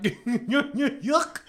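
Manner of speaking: high, mocking yokel voice; hammed-up disgusted yokel; mocking Southerner. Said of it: "they're just like".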